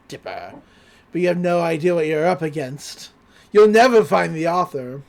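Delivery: acted menacing monster voice; villainous taunt. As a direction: taunt like a gloating cartoon villain. Monster taunts Dipper before freezing.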